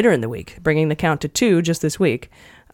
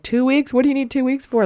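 incredulous quote; 9 semitones higher than usual. Retold or imitated. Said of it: "everyone's like".

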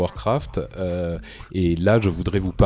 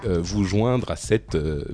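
usual speaking voice

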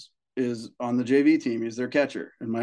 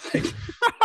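high squeak of laughter. Real, not acted. Reacting to dad's absurd meeting.

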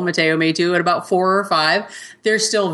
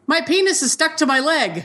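put-on higher, small child's voice; little boy voice; 9 semitones higher than usual. Mom retells her son in the bread aisle, in a little boy's voice. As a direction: sound like a worried little boy. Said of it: "My little voice goes".

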